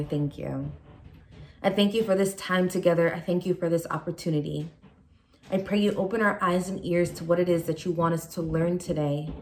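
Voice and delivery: ordinary talk, nothing marked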